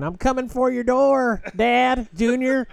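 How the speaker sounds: high, loud, slow taunting call; Keaton impression. Podcast host imitating a rival coming for dad's door, high loud call. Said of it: "all I hear right now is Keaton saying".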